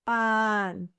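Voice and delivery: PAN with a long, held A; slow, sustained "pan" for the listener; drawn-out demonstration. Shown as a demonstration. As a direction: stretch the vowel in "pan". Word stretched for listening practice.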